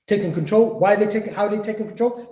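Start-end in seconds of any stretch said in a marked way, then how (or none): none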